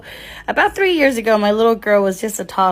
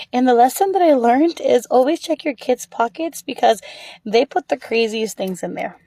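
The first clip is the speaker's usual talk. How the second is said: ordinary delivery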